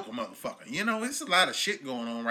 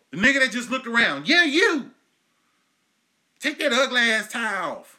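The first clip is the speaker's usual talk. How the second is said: higher, louder comedic yelling; mock angry rant; 9 semitones higher, 7 dB louder than usual. Host yelling at a listener in an ugly tie, hammed-up fury. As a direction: chew out the guy in the tie, over the top.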